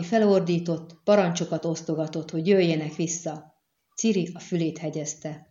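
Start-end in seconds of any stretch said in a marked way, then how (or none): none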